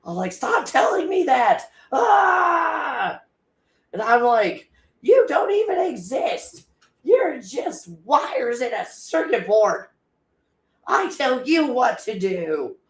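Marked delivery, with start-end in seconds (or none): 0.3-3.2 high, loud, slow yell; mock angry yell at AI. Retelling yelling at the AI, high and loud. 5.0-12.8 mock angry rant. Retold rant at the AI.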